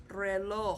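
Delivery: reloj said reh-LOH with a breathed final H; Spanish pronunciation. Shown as a demonstration. Teacher pronouncing a classroom object, reloj stressed on O. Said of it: "the stress is on the O and the J sound".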